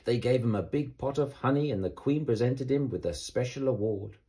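ordinary delivery, nothing marked

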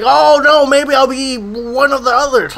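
hammed-up flip-flopping bad guy; mock indecisive villain. Mocking the villain who keeps changing his mind, ditzy whine.

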